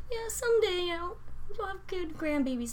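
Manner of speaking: high, sweet mock of a hopeful grandma; mocking boss's mom. Imitating boss's mom, high and sweet. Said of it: "or she's like".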